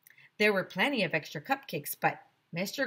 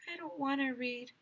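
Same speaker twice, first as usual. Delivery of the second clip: put-on sad kid; 7 semitones higher, 8 dB quieter than usual. A narrator's reading. Emily tearful, speaking softly.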